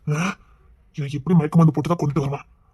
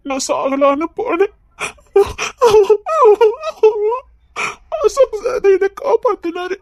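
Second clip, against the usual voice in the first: tearful; 14 semitones higher, 9 dB louder, slower than usual. Acted. Girl tells tragic past, emotional.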